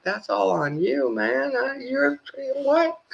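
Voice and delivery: mock outraged pushback, very high; incredulous protest. Retelling arguing on the phone.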